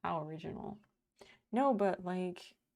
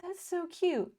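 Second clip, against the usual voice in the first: gushing; 11 semitones higher, 4 dB louder than usual. Real, not acted. Gushing over viewer message.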